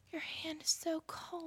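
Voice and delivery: high, breathless whisper; hushed mock-dramatic gasp of wonder; whispered awe. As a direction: whisper it like a secret discovery. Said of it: "she's like".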